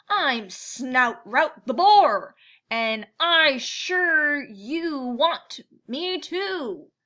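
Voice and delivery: slow, loud, rough-voiced boar. Boar announcing himself to the crowded mitten, slow and loud. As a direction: lean on it like a big swaggering boar.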